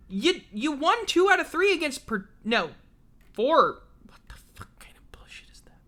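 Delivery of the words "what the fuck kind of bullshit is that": fast whispered complaint; whispered disbelief. Real, not acted. Reading baseball stats, whispered disbelief. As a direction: hiss it under your breath.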